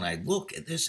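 ordinary talk

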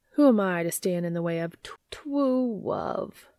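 pinch the nose and tease "tw-, tw-, love"; nasally voice. Acted. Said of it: "he adds in a nasally voice".

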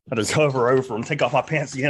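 usual speaking voice

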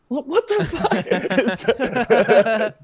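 high, drawn-out comic alarm; panicked disbelief. Retold or imitated. Actor realising rehearsal is over, panicked disbelief. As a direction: blurt it slowly in high-pitched mock panic.

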